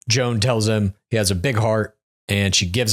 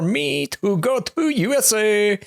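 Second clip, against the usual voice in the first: put-on slow, hammy French accent, much higher; 10 semitones higher, slower than usual. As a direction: fake French accent drifting into Borat. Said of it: "Is that close to a French accent?".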